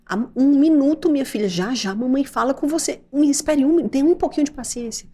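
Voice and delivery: loud, high and firm; stern loud tone. Shown as a demonstration. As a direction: snap it out sharply at the kid. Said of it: "Desse jeito que eu tô falando".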